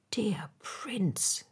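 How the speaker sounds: soft, hushed reluctance; quiet storybook sorrow; sorrowful plea. A narrator's reading. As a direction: plead gently, close to weeping. Swallow upset, about to weep.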